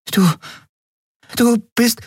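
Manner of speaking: high, halting stammer of astonishment; speechless. A narrator's reading. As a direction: falter on each word, lost for words. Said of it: "erlebte ihre Freundin zum ersten Mal sprachlos".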